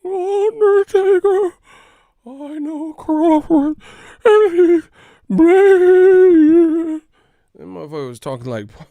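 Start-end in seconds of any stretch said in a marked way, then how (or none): 0.1-7.0 very high, loud, slow screeching wail; Paul Bearer impression. Mimicking Paul Bearer calling Undertaker, very high screeching wail. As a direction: screech like the wrestling manager. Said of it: "Paul Bearer".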